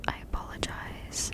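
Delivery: usual speaking voice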